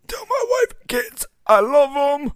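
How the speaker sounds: high, strained dying words; a hammed-up last farewell, high; wounded soldier gasping. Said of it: "He's like".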